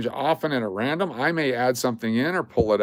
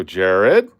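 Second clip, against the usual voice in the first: mock calling out; 2 semitones lower, 6 dB louder than usual. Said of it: "I'm just go".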